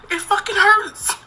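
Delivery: high, strained, slow breaking voice. Real, not acted. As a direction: let the hurt crack the words. Emotional confession, voice breaking.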